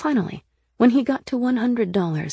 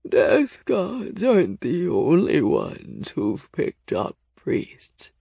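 very slow, creaky ancient voice sinking low; old, scratchy voice; 3 semitones lower, 3 dB louder, much slower than usual. A narrator's reading. Bent figure answers the visitors after laughing, old and creaky. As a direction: croak it out slowly, aged and amused. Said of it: "their voice so old and scratchy".

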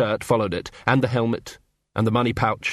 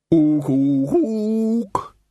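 high, loud ape ooks; ape hoots; 7 semitones higher, 3 dB louder, much slower than usual. A narrator's reading. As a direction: hoot like an excited ape.

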